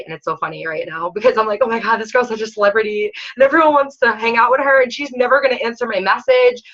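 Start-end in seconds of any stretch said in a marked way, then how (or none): 1.6-6.6 starstruck fangirl. Retold or imitated. Coach mocking her younger self about a famous coach, starstruck gushing. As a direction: gush like a starstruck fan.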